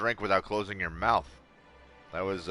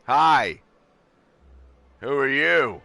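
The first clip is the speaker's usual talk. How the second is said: loud, high greeting; excited; 5 semitones higher, 11 dB louder, slower than usual. Real, not acted. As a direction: greet her loudly.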